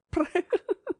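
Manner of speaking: high little giggle; a quick, high, stifled giggle; cat giggle. A narrator's reading. Cat giggling at the painted buffalo, high giggle. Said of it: "she giggled".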